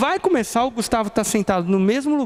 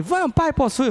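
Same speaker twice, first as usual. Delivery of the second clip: put-on child voice; 7 semitones higher than usual. Son begging father.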